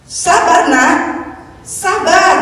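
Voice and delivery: put-on be God urging his child to wait; God comforting. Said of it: "Tuhan bilang".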